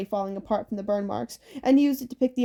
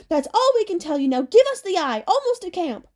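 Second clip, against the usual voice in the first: high, urgent character yell; 8 semitones higher, 4 dB louder than usual. A narrator's reading. Anger demanding the eye near camp, yelled. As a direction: pitch it high and call it out. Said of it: "Anger yelled".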